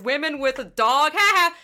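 high, loud sneering mock voice; mocking jeer. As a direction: jeer like a smug onlooker. Mocking onlookers.

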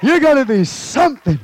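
fired-up preacher promise; preaching at full force. Acted. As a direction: stretch it out like a rising sermon climax.

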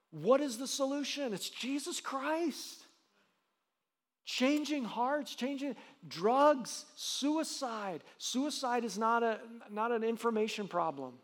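high, slow, ringing preacher cadence; preaching at full force. Acted. Pastor proclaiming Jesus as the answer, high and slow. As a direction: lift each phrase up and stretch it out.

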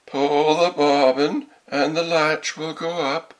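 high, very drawn-out coaxing wolf; wolf faking a sweet granny, high, slow and loud; wolf's softened voice. A narrator's reading. Wolf luring the girl inside, falsely softened voice. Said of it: "softening his voice as much as he could".

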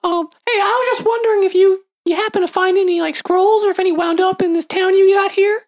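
character voice. Acted. Player voicing character to shopkeeper.

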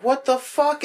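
high indignant what-the-fuck; mock shock at getting hit; high-pitched outraged disbelief; imitated outraged surprise. Hit by blue shell.

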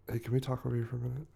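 quiet, fast private aside; hushed aside. Acted. Pulls friend aside privately.

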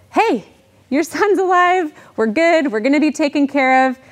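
overjoyed brothers calling out to their father; excited good news. Retold or imitated. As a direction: blurt out the news like brothers who can't wait. Brothers bring Jacob the good news.

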